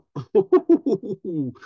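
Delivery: high exasperated laugh; a short, high disbelieving laugh. Real, not acted. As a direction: laugh at the absurdity.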